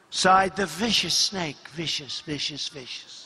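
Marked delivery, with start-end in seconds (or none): 1.7-3.3 low, hushed repetition; very soft, low echo; muttered aside. A narrator's reading. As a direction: drop right down and repeat it under your breath. Muttered echo after snake's line.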